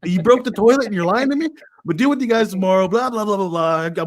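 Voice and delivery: mock-upset dad rant, rising in pitch and volume. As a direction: act out a dad telling off his boys. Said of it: "then I'm getting upset".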